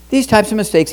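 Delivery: normal talking voice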